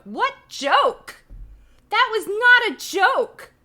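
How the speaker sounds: high, very loud protest; indignant shout. Acted. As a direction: blow up in comic outrage. Mock outburst at the book's joke.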